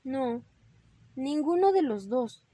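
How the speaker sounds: understated tearful admission; a touch of a sob; little rabbit mildly tearful; sobbing. Acted. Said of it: "dijo Ramón sollozando".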